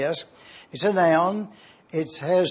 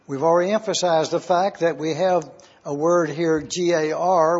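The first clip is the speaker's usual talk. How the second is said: ordinary delivery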